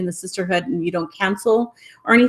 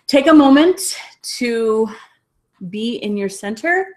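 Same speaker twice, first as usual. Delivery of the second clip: guided meditation blessing. Real, not acted. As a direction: guide them gently into a closing blessing, breathing between phrases. Leads closing meditation and blessing.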